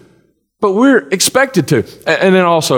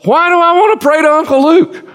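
incredulous; 8 semitones higher than usual. Real, not acted. Preacher's incredulous rhetorical question.

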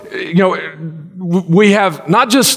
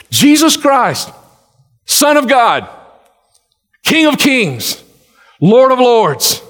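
solemn proclamation; 4 semitones higher, slower than usual. Real, not acted. Slow proclamation of Jesus's titles.

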